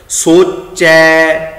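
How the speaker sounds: ਸੋਚੈ very slow with an open ai; dulavan ending. Shown as a demonstration. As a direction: stretch the ai of ਸੋਚੈ. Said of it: "ਸੋਚੇ ਨਹੀਂ ਪੜ੍ਹਨਾ". (ਸੋਚੈ ਦੁਲਾਵਾਂ ਨਾਲ ਲਮਕਾ ਕੇ)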